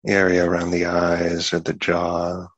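unhurried meditation guidance with long pauses; guided meditation. Acted. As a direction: ease them into relaxing the body, one part at a time.

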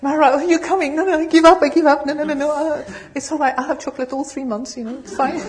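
high, loud, frantic pleading ego voice; panicky ego. Retold or imitated. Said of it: "But the ego said".

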